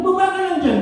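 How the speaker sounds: lightly voiced mocking mob; jeering taunt. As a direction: taunt him from the crowd. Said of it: "At gusto nila patatayin mo".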